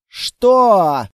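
loud barked what; barked in chorus at the director. Acted. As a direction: snap it out loud and sharp. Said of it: "рявкнули мы с Клэр хором".

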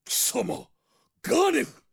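high cry rising to a shout; alarmed, angry exclamation; hostile recognition. Acted. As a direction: spit out the enemy's name. Knight recognizes the enemy sorcerer.

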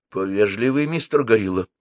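plain reading voice

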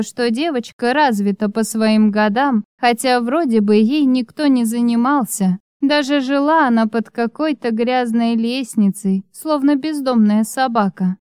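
ordinary delivery

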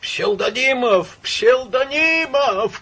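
high, loud, long-drawn cry; stretched-out, imploring shout; desperate calling out. A narrator's reading. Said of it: "кричал Иван Ильич".

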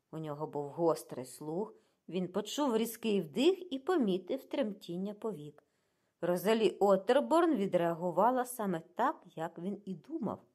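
ordinary delivery, nothing marked

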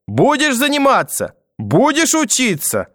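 very high, slow shrieked demand; hysterical screaming. A narrator's reading. Grandmother losing control over trembling grandson, screaming hysterically. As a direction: screech it on the edge of breakdown. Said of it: "кричала бабушка".

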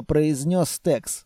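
ordinary delivery

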